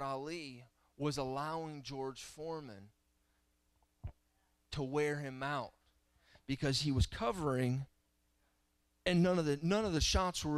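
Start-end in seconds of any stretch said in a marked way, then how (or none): none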